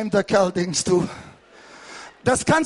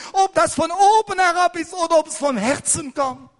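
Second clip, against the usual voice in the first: preaching at full force; 6 semitones higher than usual. Real, not acted. Preacher raises voice again.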